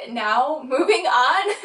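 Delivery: slow, laughing and crying at once; tearful laugh. Real, not acted. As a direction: pull yourself together through real tears. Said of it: "I'm trying not to cry on camera".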